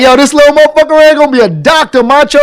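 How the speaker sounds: high, loud mock boss voice; a hammed-up boss brushing him off; imitating the boss. Storyteller mimics the boss dismissing him, high and loud.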